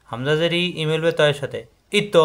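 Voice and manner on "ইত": ইত with a bounced final ত; qalqalah bounce. Shown as a demonstration. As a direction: release ত in ইত with a short echo. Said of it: "বাড়ি খাবে".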